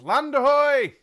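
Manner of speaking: high, very loud drawn-out cry; yelling lookout's shout. A narrator's reading. Mungo hailing land from the boat, yelling. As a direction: bellow it out to a whole ship's crew. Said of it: "as though he were yelling the news".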